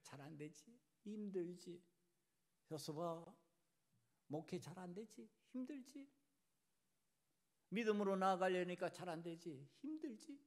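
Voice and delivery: hushed, tender comforting questions; the Lord, consoling. Acted. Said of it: "그때 주님께서 우리를 위로해 주시는 거예요".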